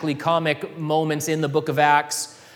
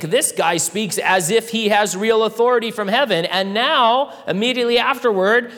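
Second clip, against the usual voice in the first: raised, louder preaching voice; preaching at full force; 6 semitones higher, 4 dB louder than usual. Acted. Pastor driving home Christ's authority, preaching louder. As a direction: build it up like a sermon climax.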